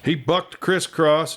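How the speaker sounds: ordinary delivery, nothing marked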